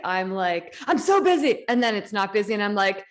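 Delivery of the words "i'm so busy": high, hammed-up overwhelm; higher-pitched comic fluster; mock frazzled. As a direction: play up being swamped. Acts out her own panicked reaction.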